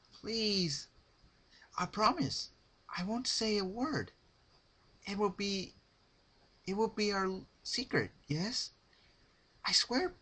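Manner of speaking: high, trembling pleading pony voice; Rarity pleading. Acted. Rarity begging Pinkie to let her go, high pleading. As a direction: beg for release in a high voice.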